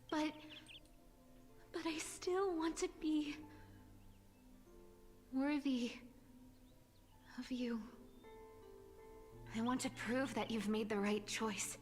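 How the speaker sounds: distressed. Acted. Cypher's heartfelt confession.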